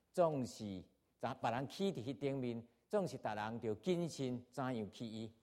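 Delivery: steady, measured Bible reading to the congregation; scripture reading. Acted. Pastor reading First Corinthians to the church, steady and measured. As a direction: read the passage out evenly, verse by verse.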